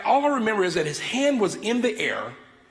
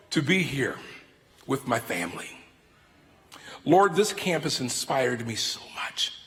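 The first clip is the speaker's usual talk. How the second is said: opening prayer; 8 semitones lower than usual. Acted. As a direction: pray reverently over the congregation.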